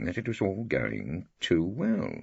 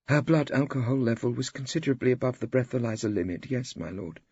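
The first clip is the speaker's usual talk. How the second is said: higher, prim lady doctor; doctor witness; 7 semitones higher than usual. A narrator's reading. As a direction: answer the judge properly. Doctor witness answers judge.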